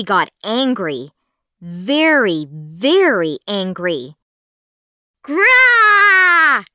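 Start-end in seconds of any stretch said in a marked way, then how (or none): 5.2-6.7 much higher, loud zombie shriek; furious zombie scream. A narrator's reading. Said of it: "she screamed".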